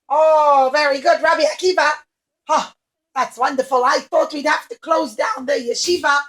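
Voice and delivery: very loud, bursting with relief; thrilled cook. Acted. The cook cheering Rabbi Akiva's return, thrilled. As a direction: shout it out with delight.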